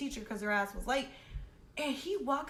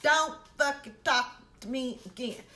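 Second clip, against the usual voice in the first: a hint of a scolding mother, louder; scolding mama; 3 semitones higher, 6 dB louder than usual. Warning the girl like a mother scolding her child, mildly louder. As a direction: hand it down like a mom disciplining a kid. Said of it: "Like how your mama used to thump you".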